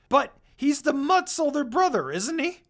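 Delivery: high, loud accusing question; loud challenge. Acted. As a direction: throw it out as an accusation. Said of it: "Koga asked".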